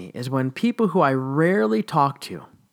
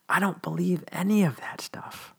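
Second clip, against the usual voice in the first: whisper; 4 semitones higher, 6 dB quieter than usual. Real, not acted. Firm denial as a closing.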